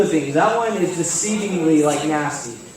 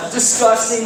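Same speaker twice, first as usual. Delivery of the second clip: normal talking voice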